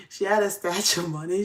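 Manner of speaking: ordinary delivery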